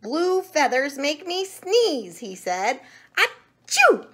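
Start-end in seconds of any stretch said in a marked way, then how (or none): 0.0-2.2 high, raised-voice complaint; exclaimed complaint. A narrator's reading. The sneezing monkey blames blue feathers, loud complaint. 3.2-4.1 loud, high explosive achoo; acted sneeze. A narrator's reading. The monkey reacts to feathers, big acted sneeze. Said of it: "began to sneeze".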